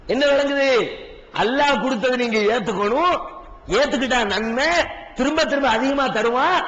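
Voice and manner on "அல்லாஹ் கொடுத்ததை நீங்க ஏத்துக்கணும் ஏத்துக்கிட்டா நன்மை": usual speaking voice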